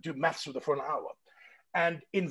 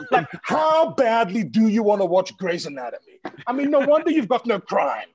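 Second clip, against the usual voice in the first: loud, raised-pitch tirade; comic exasperated rant; 5 semitones higher, 8 dB louder than usual. Retold or imitated. Mocking her boast of crime-free Canada, loud exasperated rant. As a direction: build to an incredulous loud punchline.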